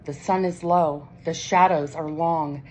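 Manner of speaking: ordinary delivery, nothing marked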